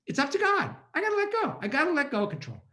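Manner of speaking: high, urgent, a touch of surrender; emotional inner plea. Retold or imitated. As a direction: cry out to let go. Inner plea while driving.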